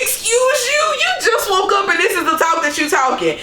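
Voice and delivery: very high, shocked squeal easing down; mock outrage. Host replays her reaction to what G said on waking, mock outrage. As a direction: recoil in hammed-up disbelief.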